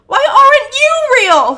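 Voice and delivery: high, continuous shriek in one breath; anguished shriek. A narrator's reading. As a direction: shriek the whole question in one run. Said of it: "he shrieks".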